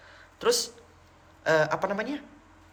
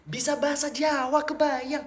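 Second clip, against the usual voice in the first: high, incredulous exclaiming; amazed; 9 semitones higher than usual. Retold or imitated. Telling how the Indian man even speaks Javanese, amazed. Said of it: "Saya kaget".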